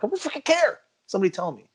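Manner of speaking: frustrated patient insisting someone finally talk; exasperated demand. Retold or imitated. Patient to hospital assistant, high exasperated demand. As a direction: pitch jumps way up, pushing to be told.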